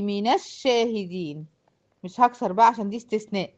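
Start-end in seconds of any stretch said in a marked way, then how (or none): 0.0-1.5 slow "من الشاهدين" as mina-shshahidin; measured tajweed example, "من الشاهدين" joined by a; demonstrating the fatha link. Shown as a demonstration. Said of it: "تقولي".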